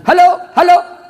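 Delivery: high, loud mock hello; phone shouting. Villager shouting into upside-down handset, mock phone yell. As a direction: call out twice into a dead receiver.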